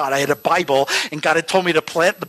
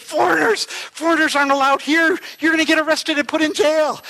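high, agitated warning; startled alarm; 10 semitones higher than usual. Retold or imitated. Startled local warning foreigners caught by the tree, high alarmed voice. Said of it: "sees us and freaks out".

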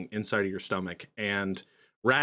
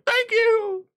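squeaky high grateful chicken; 4 dB louder than usual. Acted. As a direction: chirp it out in a tiny high voice. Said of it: "he's like".

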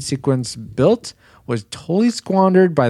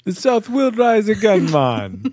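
high, loose attempt at a Southern accent; mock Southern accent; 10 semitones higher than usual. Host showing off to co-hosts, joking Southern accent. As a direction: put on a hammed-up Southern accent. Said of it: "There's my, uh, Southern".

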